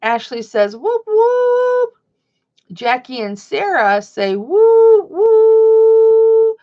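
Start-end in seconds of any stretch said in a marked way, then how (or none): 0.8-1.9 high, loud acted cheer; cheering whoop. Host voicing a viewer's cheer for mom, cheering whoop. Reading chat cheer aloud. 4.4-6.6 very high, loud, stretched whoops; cheering whoop. Acted. Host voicing two viewers cheering mom, cheering whoop. Reading chat cheer aloud.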